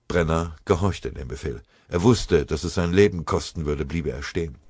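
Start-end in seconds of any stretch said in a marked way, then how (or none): none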